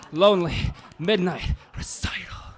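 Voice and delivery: ordinary talk, nothing marked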